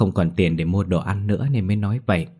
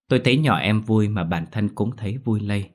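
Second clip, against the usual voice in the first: usual speaking voice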